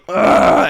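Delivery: loud, voiceless mock growl of frustration; imitated angry grunt. Furious heckler walking the wrong way, imitated angry grunt. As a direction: force out an exasperated "ugh".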